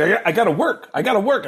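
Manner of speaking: high-pitched imitated excuse, repeated; mock insistent brush-off. Director getting rid of a clingy fan, imitated insistence.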